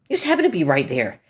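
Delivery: fast repeat of the same words; quick repeat. Acted. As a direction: rattle it off as a quick echo. Quick mocking repeat of own line.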